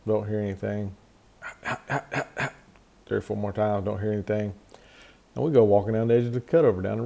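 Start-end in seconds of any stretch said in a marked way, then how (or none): 1.4-2.5 imitated turkey call. Imitating his box call again.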